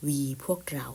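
hushed repeat for the class; whisper. Shown as a demonstration. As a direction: softly whisper the word and its meaning. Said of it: "teacher repeats word whispered".

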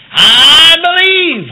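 loud, high and very slow proclamation. Retold or imitated. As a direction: proclaim it loud, stretching each word. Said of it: "out loud".

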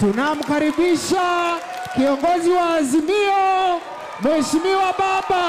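slow, loud, high announcer call; grand entrance announcement. Acted. Host announces next impression.

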